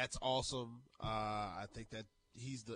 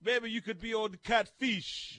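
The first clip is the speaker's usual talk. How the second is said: hammed-up Jamaican accent, slow; mock Jamaican accent, loud and high; fake Jamaican accent; 10 semitones higher, 9 dB louder, slower than usual. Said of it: "My fake Jamaican accent".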